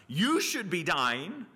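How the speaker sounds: high, drawn-out accusing cry. Acted. Sacrificial animals to sinners, crying out in accusation. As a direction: preach it as a slow, pointed cry. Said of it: "they're crying out to us".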